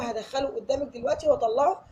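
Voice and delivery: ordinary talk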